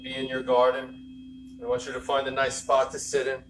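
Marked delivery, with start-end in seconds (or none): none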